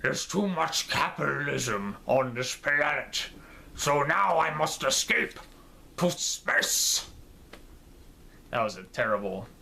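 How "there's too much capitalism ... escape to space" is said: slow, hammed-up Russian accent; Russian Tim Curry. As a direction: do a terrible Russian-accented villain impression, drawn out. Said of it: "a Russian Tim Curry accent".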